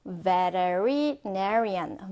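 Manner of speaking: "veterinarian" drawn out very slowly, part by part; careful, slowed-down "veterinarian"; slow pronunciation. Shown as a demonstration. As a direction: stretch out "veterinarian" so learners can follow. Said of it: "천천히 한번 따라해볼까요".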